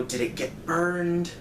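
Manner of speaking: normal talking voice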